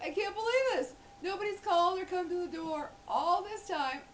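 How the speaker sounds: high, loud mock exasperation; exasperated. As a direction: complain in high-pitched disbelief. Acted outburst at bad timing.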